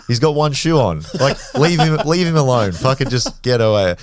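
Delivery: high, loud yell to break up the fight; yelling at the guys to leave the drunk friend alone; retold shouting.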